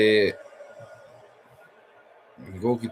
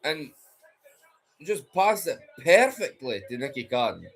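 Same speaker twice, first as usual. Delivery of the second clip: higher, animated pundit voice; live commentary; 9 semitones higher than usual. Acted. Pundit breaking down the Hibs goal for viewers. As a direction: talk it through like a TV commentator.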